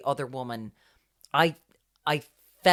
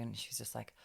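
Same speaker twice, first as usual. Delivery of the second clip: ordinary delivery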